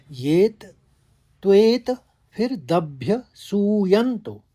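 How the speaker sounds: "येत", "त्वेत", "दभ्य सूयंतो" apart, each struck firmly; stressed syllable breakdown. Shown as a demonstration. Teacher shows students where the stress falls, "येत, त्वेत" in separate stressed pieces. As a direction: punch "त्वेत" high and loud, pausing between pieces. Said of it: "हमने आघात लिया".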